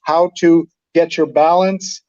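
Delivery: normal talking voice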